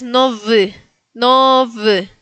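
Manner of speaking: "no v" with a buzzed V. Shown as a demonstration. Teacher gives second Polish example, "no v" with buzzed V. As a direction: say "no v" with a vibrating V before the final vowel. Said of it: "como B corta, se pronuncia".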